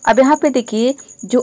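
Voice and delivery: usual speaking voice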